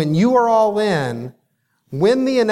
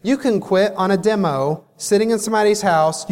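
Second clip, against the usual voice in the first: ordinary talk, nothing marked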